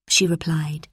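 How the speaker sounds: ordinary delivery, nothing marked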